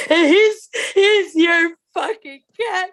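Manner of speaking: high, loud, laughing 'your cat, not mine'; loud laughing protest. Retold or imitated. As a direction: shout it at the husband through laughter.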